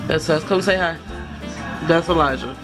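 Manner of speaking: ordinary delivery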